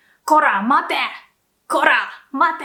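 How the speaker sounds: loud, menacing こら、待て; threatening yell. Shown as a demonstration. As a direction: yell it like a yakuza chasing someone. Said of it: "sound more threatening".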